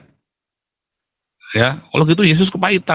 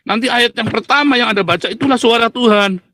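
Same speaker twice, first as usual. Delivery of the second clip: higher-pitched mock instruction; imitated pastor; 7 semitones higher than usual. Quoting pastors who teach hearing God's voice, imitated pastor. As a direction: lightly mimic another preacher's higher delivery. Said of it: "Dia bilang begitu".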